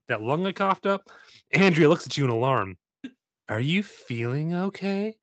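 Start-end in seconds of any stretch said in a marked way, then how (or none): 3.5-5.1 gentle concern. Acted. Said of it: "sorry, sorry, I'll do one for Jay".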